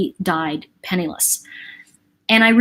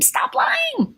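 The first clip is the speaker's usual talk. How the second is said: exasperated stop lying. Retold or imitated. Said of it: "even though I told him".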